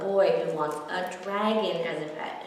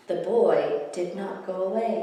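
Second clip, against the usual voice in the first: plain reading voice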